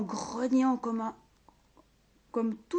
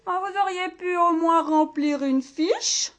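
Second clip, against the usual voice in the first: higher, louder fussy complaint; complaining librarian; 7 semitones higher, 6 dB louder than usual. Acted. Librarian scolding the ogre, high and peevish. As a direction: whine about the paperwork. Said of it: "se plaignit Hortense".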